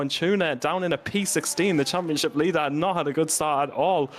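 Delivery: high, excited motorsport commentary; live play-by-play. Real, not acted. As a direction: call the race start with rising urgency. Live race start commentary.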